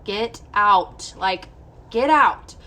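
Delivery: loud, punched retold order; forceful demand. Patient retells ordering doctor out, loud forceful demand.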